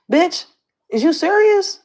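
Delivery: high-pitched indignant disbelief; incredulous. Real, not acted. Livestreamer reacting to a woman's posts online, incredulous. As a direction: shoot up high, appalled and questioning.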